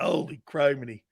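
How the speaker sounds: normal talking voice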